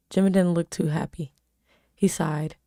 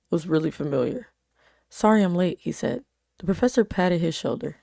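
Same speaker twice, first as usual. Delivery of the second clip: plain reading voice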